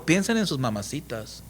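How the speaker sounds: hushed, mock-gentle mimicry of the president; a soft mocking murmur; mocking soft quote. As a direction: say it softly, sending up the advice. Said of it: "También dijo".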